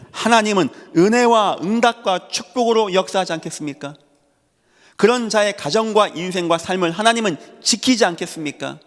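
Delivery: high, surging sermon delivery; impassioned preaching. Real, not acted. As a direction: press each point on the congregation, pitched high. Preaches rhetorical questions with force. (높은 어조로 열정적으로 호소하는 설교)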